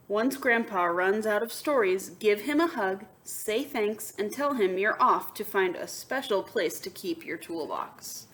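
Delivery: bright read-aloud to the class; lively storybook voice; story narration. A narrator's reading. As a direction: read it like a picture book to kids. Reads picture book aloud.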